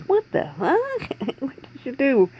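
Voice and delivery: high, slow, stunned outburst with a laugh; shocked dentist. Pretend. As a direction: play the dentist gasping in disbelief. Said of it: "I'm hoping my dentist is like".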